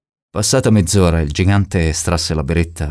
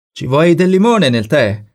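high, drawn-out call; calling from the kitchen; 10 semitones higher, 4 dB louder than usual. A narrator's reading. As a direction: call a question through from another room. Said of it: "domandò dalla cucina". (richiamo acuto dalla cucina)

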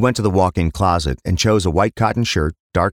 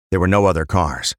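ordinary delivery

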